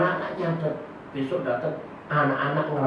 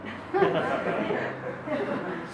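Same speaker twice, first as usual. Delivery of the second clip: breathy laugh; 12 semitones higher, 5 dB quieter than usual. Real, not acted. Laugh heard, unvoiced.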